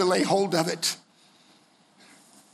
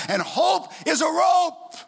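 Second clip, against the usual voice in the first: high, slow climax line; preaching shout; 9 semitones higher, slower than usual. Acted. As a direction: ring out the motto. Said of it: "sermon climax".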